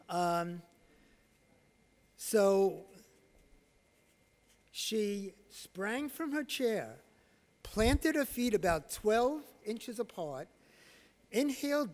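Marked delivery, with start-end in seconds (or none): none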